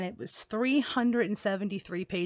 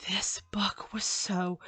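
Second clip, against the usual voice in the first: hushed whisper, drawn out slowly; a slow, confiding whisper before the payoff; 6 dB quieter, slower than usual. Real, not acted. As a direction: lean in and breathe it like a secret. Hushed build-up about the book.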